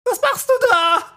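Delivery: very high, loud scream from far away; shrill-free high yell, faraway; distant girl's scream. A narrator's reading. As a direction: shout it across a great distance. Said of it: "schrie eine Mädchenstimme von irgendwo ganz weit entfernt".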